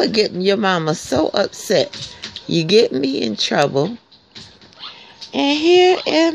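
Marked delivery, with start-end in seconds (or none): none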